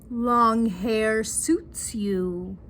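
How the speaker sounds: very slow, drawn-out compliment; smitten husband imitation. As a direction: stretch each word like a besotted husband.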